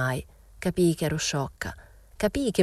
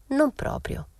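lifted, high answer; a high, light Momina voice; light higher reply; 10 semitones higher, slower than usual. Acted. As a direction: toss it off up high.

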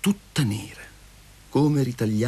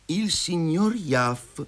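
slow beginning; 6 semitones higher, much slower than usual. A narrator's reading. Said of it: "incominciò Masha".

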